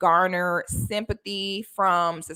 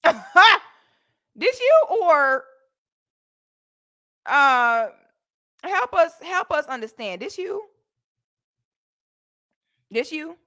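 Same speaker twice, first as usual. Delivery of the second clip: mocking taunt; 7 semitones higher, 4 dB louder than usual. Continuing taunts.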